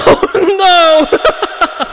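high cry, fading; hammed-up falling cry; mock-panicked wail. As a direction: wail in playful horror. Falling in game.

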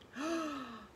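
soft, high gasp; sharp intake of dismayed breath; shocked gasp. Acted. Mamas are missing them and crying.

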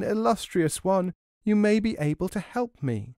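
high, wheedling lament; keening hag. A narrator's reading. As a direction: flatter and wail like a beggar. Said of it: "the hag started to keen and sway".

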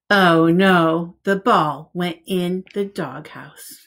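lively picture-book read-aloud; reading to children. Acted. Teacher reading a picture book to preschoolers, lively storytime voice. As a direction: read it out like storytime for little ones.

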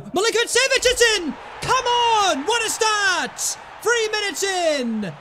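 goal celebration. Real, not acted. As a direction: cheer the early goal.

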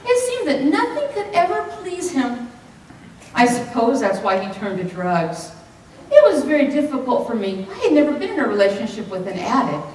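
ordinary delivery

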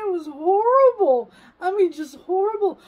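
high, loud, near tears; tearful. Real, not acted. Recounting the bathtub ordeal, loud and near tears. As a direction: voice breaking on horrible.

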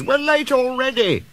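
high-pitched, impatient complaint; grumbling. A narrator's reading. Passengers held up by the argument, grumbling. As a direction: protest as a fed-up passenger. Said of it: "grumbled the passengers".

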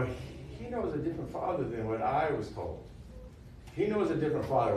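soft, awed murmur of discovery; hushed realization. Retold or imitated. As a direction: say it quietly as if it just dawned on you. Awed realization, quoted inner voice.

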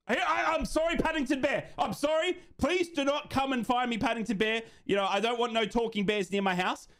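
high-pitched, hammed-up apology; mock pleading. Streamer apologising to Paddington Bear over marmalade, mock pleading. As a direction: beg in a high, playfully scared voice.

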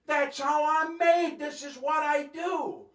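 high, loud, drawn-out acted retort; arguing back. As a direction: shout back at your mother in protest. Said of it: "we'd argue".

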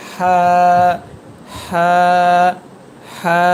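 হা held long from the middle of the throat; drawn-out pharyngeal হা; mid-throat letter. Shown as a demonstration. Teacher demonstrating the throat letter, long mid-throat হা. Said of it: "গলার মাঝখান থেকে".

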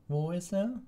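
put-on very high, thin old-timer. A narrator's reading. Old committee member looking for the beast, high thin voice. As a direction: pipe it up thinly. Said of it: "sagte die dünne Stimme des Alten".

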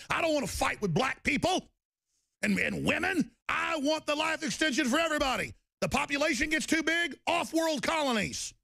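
impassioned high-pitched outburst; indignant rant. Real, not acted. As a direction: plead the case in a high, worked-up voice. Indignant rant about life extension.

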